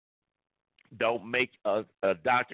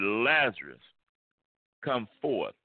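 firm, commanding call; strong command; slower than usual. Retold or imitated. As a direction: call him out with authority. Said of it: "in a strong voice".

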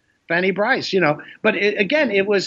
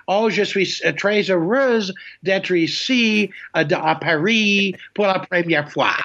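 put-on terrible American accent in French; 4 semitones higher than usual. American girl showing off French in Paris, heavy American accent. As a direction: mimic an American girl's clumsy French. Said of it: "terrible French accent, American accent".

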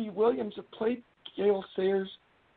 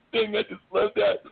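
normal talking voice

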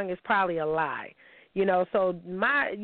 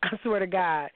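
ordinary talk, nothing marked

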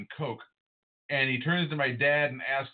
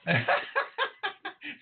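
high laugh. Real, not acted. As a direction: burst out laughing. Host laughing at cohost.

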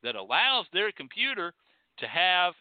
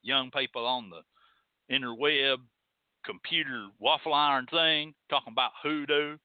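put-on lower-pitched country old-timer accent; mock country porch-sitter; 4 semitones lower than usual. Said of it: "somebody said".

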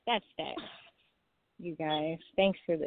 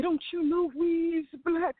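mock indignant brother; 8 semitones higher, 3 dB louder than usual. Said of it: "He said".

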